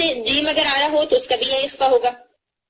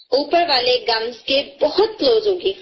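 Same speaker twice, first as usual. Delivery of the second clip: ordinary talk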